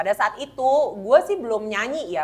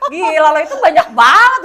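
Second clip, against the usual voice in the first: high, loud, laughing exclamation; 8 semitones higher, 14 dB louder than usual. Real, not acted. Marvelling to a friend at how many there were, laughing. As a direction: keep laughing in disbelief.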